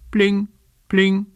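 high little pling of a muffled alarm; a hint of a little bell; tiny pling. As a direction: chime it high and small. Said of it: "macht sich nur ganz leise".

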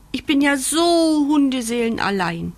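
high, falling puppy whine; wailing. A narrator's reading. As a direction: yowl out the loneliness. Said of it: "jault Bandit".

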